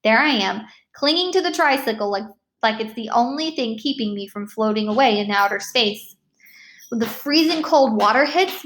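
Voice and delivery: ordinary delivery, nothing marked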